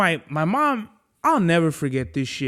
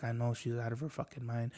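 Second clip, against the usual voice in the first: much softer, dropped to a confiding undertone; hushed aside; 5 semitones lower, 16 dB quieter than usual. Retold or imitated. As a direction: say it low so mom won't hear. Aside to grandma about his mom.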